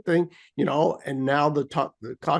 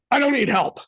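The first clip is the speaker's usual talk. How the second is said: frustrated protest; 8 semitones higher than usual. Retold or imitated. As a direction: protest at the machine, rising in frustration. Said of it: "It's like".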